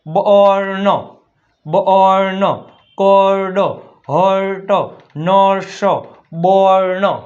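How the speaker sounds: બર્ન, કોર્ડ, હર્ટ, નર્સ loud and drawn out as "bor-no"; demonstrating u-r vowel. Shown as a demonstration. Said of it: "અ થાય છે ઉચ્ચાર". (બર્ન, કોર્ડ, હર્ટ, નર્સ લંબાવીને, ભાર દઈને)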